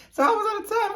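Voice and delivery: high mock kid. Kid asking, retold.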